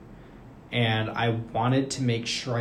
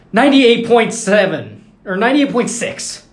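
high, booming announcement of a reading; mock shouted readout; 10 semitones higher, 12 dB louder than usual. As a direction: imitate a roommate loudly calling out numbers. Roommate calls out his reading.

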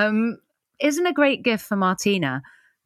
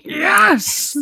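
cheering yes; 11 semitones higher, 4 dB louder than usual. Retold or imitated. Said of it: "everybody else behind you is going".

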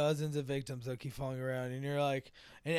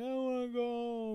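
mock child crying; 7 semitones higher, 3 dB quieter than usual. Voicing crying ghost kids.

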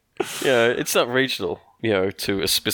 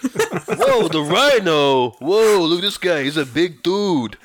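put-on loud, high-pitched New York accent; New York Spider-Man; 7 semitones higher, 5 dB louder, slower than usual. Spider-Man confronting the villains, loud New York accent. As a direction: shout out like a hammy New York cartoon hero. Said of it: "me doing an audition for Spider-Man".

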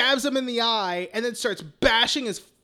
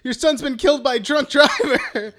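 lightly voiced police officer, louder and higher; mock cop; 4 semitones higher, 6 dB louder than usual. Friend retelling a Joker scene, mock cop voice. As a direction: play the cop at the door with fake solemnity.

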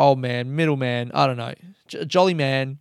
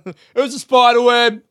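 laughing; 9 semitones higher, 8 dB louder than usual. Real, not acted. Laughing after the yell.

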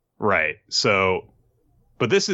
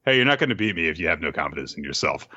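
fast, low, offhand brush-off; dismissive; 5 semitones lower, much faster than usual. Acted. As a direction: toss it off dismissively, no drama. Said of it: "He says it dismissively".

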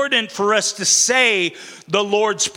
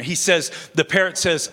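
ordinary talk

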